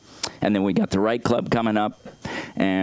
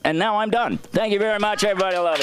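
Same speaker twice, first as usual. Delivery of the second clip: thanks blurted out way up high; high excited sign-off; 12 semitones higher, faster than usual. Real, not acted. Comedian thanking the crowd, high and excited. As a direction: sing out the thanks, high and bright.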